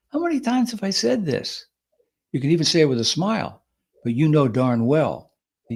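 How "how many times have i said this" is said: said very gently. Shown as a demonstration. Host showing how the dig sounds said gently. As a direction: coax it out gently, almost kindly. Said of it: "that can be said very gently".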